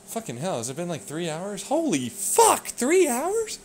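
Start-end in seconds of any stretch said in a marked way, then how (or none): 1.6-3.6 high, slow disbelief at the time; shocked. Real, not acted. Realizing hours passed, slow high shock. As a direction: gasp at how long it's been.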